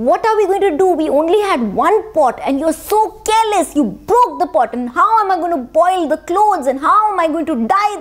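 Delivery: high-pitched exasperated scolding; mock yelling. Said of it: "The washerman was yelling at the washerwoman".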